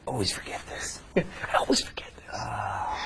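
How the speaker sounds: hushed whisper. Real, not acted. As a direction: whisper it with a laugh. Whispered aside.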